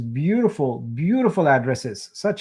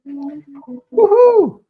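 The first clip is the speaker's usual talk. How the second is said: excited cheer; 13 semitones higher, 8 dB louder than usual. Pretend. Voicing a delighted winner.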